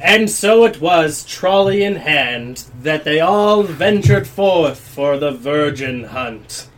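grand storybook narrator; story narration. Acted. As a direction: narrate it like an old fable. Narrator sets the scene.

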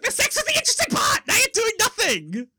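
very high, loud squealing protest through laughter; mock outrage. Host voicing a disappointed listener, hysterical mock outrage. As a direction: protest shrieking, half cracking up.

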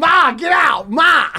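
high, loud yelling. Retold or imitated. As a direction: yell at her to leave. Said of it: "Like, yelling".

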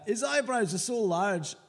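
hammed-up Scottish accent; mock Scottish accent. Co-host reading a listener's hate poem, mock Scottish accent. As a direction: read it out in a broad joke Scottish accent. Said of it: "offensive Scottish accent now".

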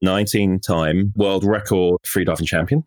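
normal talking voice

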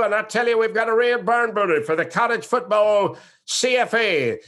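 booming, high-pitched football play-by-play caller; Keith Jackson. Shown as a demonstration. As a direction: call the game at full volume, stretching the big moments. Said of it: "close off with this impression of Keith Jackson".

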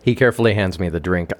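ordinary delivery, nothing marked

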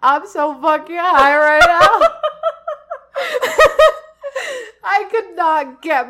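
laughing. Retold or imitated. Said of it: "I was like".

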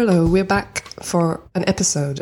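normal talking voice

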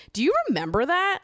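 high disbelieving question; incredulous. Real, not acted. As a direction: ask in disbelief.

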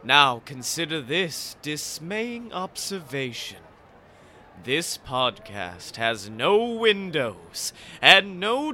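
slow sinister ghost host, rising to a loud cry; slow theatrical villain menace. Acted. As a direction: draw it out like a haunted ride narrator. Spooky Halloween episode ghost host intro.

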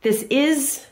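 ordinary talk

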